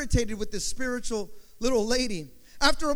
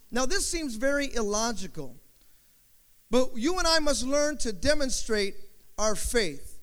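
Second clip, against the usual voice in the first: normal talking voice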